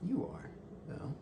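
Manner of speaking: breathy under-the-breath dig; whispered jab. Pretend. A man whispering an insult at his dog, teasing.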